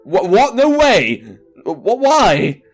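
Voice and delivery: flustered, high-pitched disbelief; shocked protest. Acted. Character balking at being asked about her sexuality, shocked. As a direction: balk in startled alarm. Acted shocked refusal in game dialogue.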